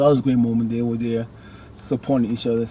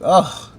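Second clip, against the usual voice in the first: mock groan; 6 semitones higher, 5 dB louder than usual. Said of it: "'cause he'd be like".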